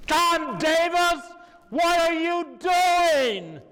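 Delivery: imitating a colleague's outraged holler, very high and slow; top-of-lungs yell. Colleague scolds the poet at a silent table, full-lunged yell. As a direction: yell it high and loud, dragging out each word. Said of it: "At the top of her lungs".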